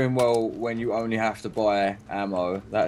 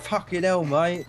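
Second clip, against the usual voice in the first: put-on cockney accent; 6 semitones higher, slower than usual. Joining the cockney bit.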